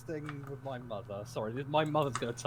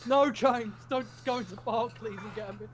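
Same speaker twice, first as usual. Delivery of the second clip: high-pitched, hurried mock-mother voice; imitated panicking mother; 8 semitones higher than usual. Mother begging son not to go to the bank, imitated panic. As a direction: plead higher, as a flustered mum. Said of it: "I said that in front of my son".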